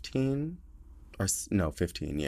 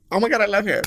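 high-pitched retold swoon; lovestruck gushing; 12 semitones higher, 4 dB louder, faster than usual. Replaying the moment of falling for him, lovestruck gushing. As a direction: gush like someone instantly smitten. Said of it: "in love, like, instantly".

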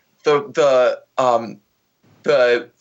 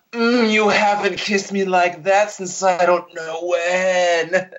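slow, drawn-out sultry purr; mock oozing sexuality; 2 semitones higher, slower than usual. Horny wife to husband on a dreary walk, mock sultry. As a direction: melt through every word, hammed-up desire. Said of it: "oozing with weird sexuality".

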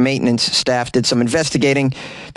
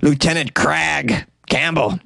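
cartoon character name; slower than usual. Shown as a demonstration. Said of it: "for a cartoon character".

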